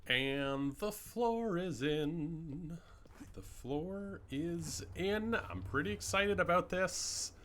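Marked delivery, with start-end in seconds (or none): 0.1-2.8 drawn out. Real, not acted. Slow drawn-out announcement. 3.3-5.4 excited. Real, not acted. Repeats announcement, excited.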